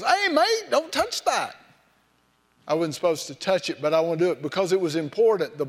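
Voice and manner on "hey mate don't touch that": high, sharp British warning voice; museum guard. Retold or imitated. Imitating a British museum guard calling out, much higher. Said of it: "some guy from over there goes".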